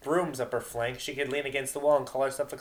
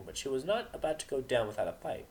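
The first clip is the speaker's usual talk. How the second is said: usual speaking voice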